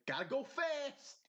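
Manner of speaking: high-pitched catchphrase voice; mock cartoon hero. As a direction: pipe it up like a cartoon speedster. Mock Sonic catchphrase in put-on voice.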